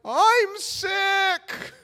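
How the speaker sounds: very high, squealed falsetto; hammed-up falsetto panic; panicked excuse. Panicked excuse to avoid swimming.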